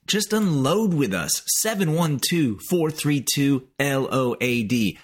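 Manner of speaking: lightly hammed-up radio promo voice; promo read. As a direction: sell the hotline like a radio ad. Host reads a phone-line promo.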